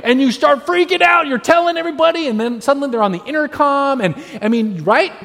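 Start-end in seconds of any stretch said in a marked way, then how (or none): 0.0-4.0 high, urgent, loud retelling; freaking out. Acted. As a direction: tell it in a mounting fluster. Acting out panic over lost child.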